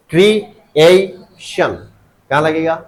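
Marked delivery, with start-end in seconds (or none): 0.1-1.7 creation very slow, in three separate beats "kree, AY, shun"; syllable by syllable. Shown as a demonstration. Teacher finds the stress, creation in three slow separated syllables. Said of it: "तो stress कहाँ लगेगा".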